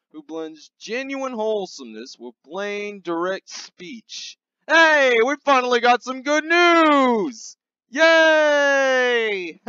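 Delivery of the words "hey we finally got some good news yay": loud, higher joyful cheer; mock-delighted yay; excited cheer. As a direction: celebrate the good news. Celebrating good news.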